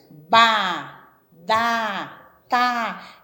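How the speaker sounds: loud, clear syllables ba, da, tá; syllable demo. Shown as a demonstration. Teacher sounding out "ba", "da", "tá" for a child. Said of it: "Por exemplo".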